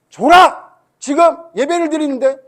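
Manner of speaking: acted rage, shouted high; furious shout. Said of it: "목사님 한번 재현을 하면".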